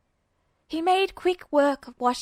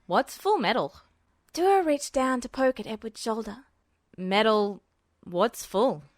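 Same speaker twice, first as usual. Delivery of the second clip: Duo's voice. A narrator's reading. Duo voiced, puzzled.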